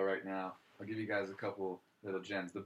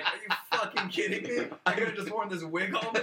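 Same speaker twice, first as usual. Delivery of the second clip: high, incredulous mock outrage; 9 semitones higher, 3 dB louder than usual. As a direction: play Will Ferrell stunned at the easy wig. Retelling Will Ferrell's outraged reaction.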